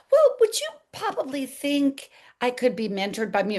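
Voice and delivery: high, hesitant mock-meek plea; timid request. Mock-asking a doctor to mentor her, timidly. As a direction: ask meekly in a high, tentative voice. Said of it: "I didn't say".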